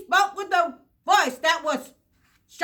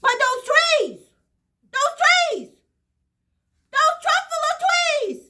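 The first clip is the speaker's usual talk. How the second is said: higher and higher in rising awe; awed wonder; 8 semitones higher than usual. A narrator's reading. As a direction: build amazement on each repeat. Narrator marvels at the trees.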